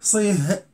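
صيه as "seeh", without the t; wrong final h. Shown as a demonstration. Teacher contrasting an impossible reading, صيه ending in h. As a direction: say صيه with h replacing t. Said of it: "لا يمكن".

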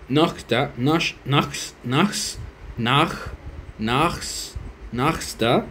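trying sounds Nachta, Nash-, Nax- for Nächster; fumbling pronunciation. Shown as a demonstration. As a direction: grope for the right ch in Nächster. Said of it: "trying out Nächster". (probando cómo se pronuncia Nächster)

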